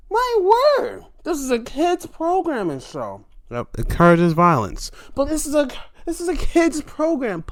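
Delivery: high-pitched mock shock; scandalized scolding. Shocked onlooker scolding Chris over kids program, scandalized.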